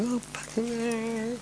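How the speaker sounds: high, soft, slow tearful curse; crying curse. Retold or imitated. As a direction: let the voice break into a teary swear. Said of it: "I was kinda crying".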